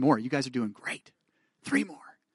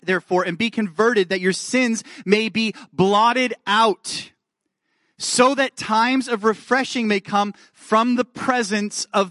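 rising, louder and slower as the scriptures pile up; building preaching; 5 semitones higher, 4 dB louder, slower than usual. Acted. Preacher reading verses to the congregation, building in fervour.

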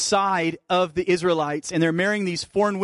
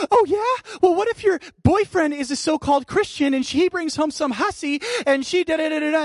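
high, loud mocking squeal of an upset woman; shrill-pitched mock of her indignant outburst; mocking angry woman; 12 semitones higher, 3 dB louder than usual. Retelling the barbershop woman's outburst, high mocking voice. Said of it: "she pipes up and she goes".

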